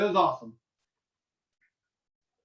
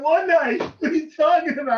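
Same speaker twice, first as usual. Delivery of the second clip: high, loud mock disbelief; retold indignant question; incredulous protest; 6 semitones higher, 6 dB louder than usual. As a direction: protest your innocence, baffled. Protesting to soldiers.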